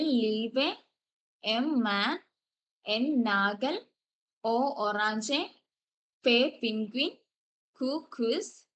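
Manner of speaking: slow "ആ, ആപ്പിൾ" with each letter and word stretched; German alphabet recital. Shown as a demonstration. Teacher reads the whole German alphabet again for the class, slowly. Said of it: "ഞാൻ ഒന്നും കൂടി വായിക്കാമേ".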